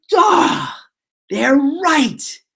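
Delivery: high, hammed-up self-reproach; exaggerated mock realization; acted dismay. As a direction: cry out as if the obvious just hit you.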